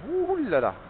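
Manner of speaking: high-pitched shivering yelp; high cold yelp. Real, not acted. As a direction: squeal it out from the cold. Reacting to the cold.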